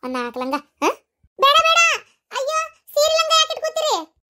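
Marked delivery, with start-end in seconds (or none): 1.4-4.2 alarmed protest. Acted. Protesting grandma packing saris.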